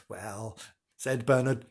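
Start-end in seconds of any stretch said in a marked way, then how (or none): 0.1-0.6 very soft, sheepish; hushed, apologetic opening; a little sorry. Acted. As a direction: say it small and contrite. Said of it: "feeling a little sorry".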